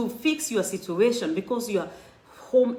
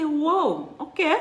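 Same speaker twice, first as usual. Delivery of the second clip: surprised; 7 semitones higher, slower than usual. Retold or imitated. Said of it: "I say".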